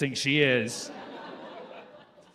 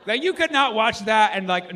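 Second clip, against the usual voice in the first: ordinary talk, nothing marked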